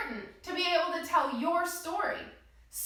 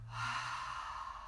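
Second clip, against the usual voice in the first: long, soft, audible exhale through the mouth; slow breath out; 12 dB quieter than usual. Shown as a demonstration. Teacher showing kids how to breathe out, long soft exhale. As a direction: let the air go out slowly and audibly. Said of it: "And breathe out".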